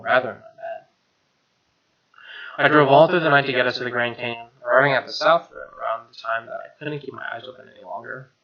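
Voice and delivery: ordinary delivery, nothing marked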